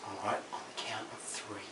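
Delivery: soft, breathy urgent whisper. Retold or imitated. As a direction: keep it to a whisper, scared. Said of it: "I was whispering this".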